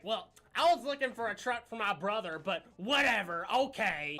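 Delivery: loud, put-on offended buyer; mock huffy reply. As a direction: huff it out, slowing on the dismissal. Acted huffy reply from would-be buyer.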